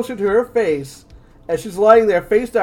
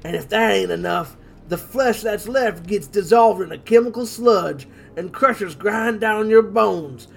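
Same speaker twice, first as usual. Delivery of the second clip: creepy hillbilly villain; slower than usual. Acted. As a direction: relish it, slowing down. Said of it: "Go for his lines".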